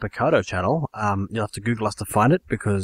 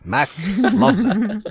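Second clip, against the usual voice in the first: laughing; 10 semitones higher, much slower than usual. Real, not acted. Teasing laugh during plugs.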